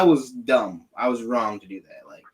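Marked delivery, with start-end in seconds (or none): none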